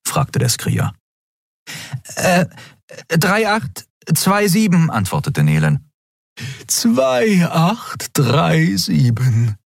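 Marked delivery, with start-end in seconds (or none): 2.1-4.9 high, slow, uncertain reciting; unsure little creature. A narrator's reading. Nelen unsurely reciting the code, high and slow. 6.7-9.5 slow, patient correcting; patient correction. A narrator's reading. Xea patiently correcting Nelen, slow and steady. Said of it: "korrigierte Xea geduldig".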